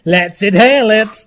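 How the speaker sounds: excited cheer. Real, not acted. Cheering on the nitrous hit.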